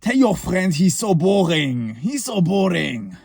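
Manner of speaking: slow, mocking French accent; lightly done French accent sneer. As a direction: imitate a French guy teasing. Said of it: "with his French accent".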